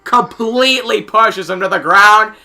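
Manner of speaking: loud, high sportscaster call; excited play-by-play. Acted. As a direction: belt out the penalty call. Calls a new penalty live.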